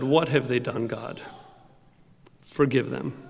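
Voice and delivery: a soft, broken murmur to God; hushed prayer. Acted. Girl kneeling over spat-on Bible, softly praying. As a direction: barely voice it, grieving over the Bible.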